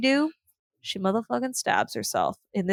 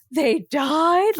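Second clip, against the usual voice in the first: higher, stunned "They died?"; mock shocked question; 6 semitones higher than usual. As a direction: play the baffled ballet-goer. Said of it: "they were like".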